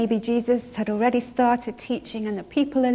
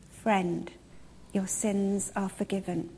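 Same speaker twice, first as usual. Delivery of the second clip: softer, slow, tender forgiveness; gentle Jesus line; 7 dB quieter than usual. Acted. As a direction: say it quietly, like a gift to a friend. Jesus speaks to the man.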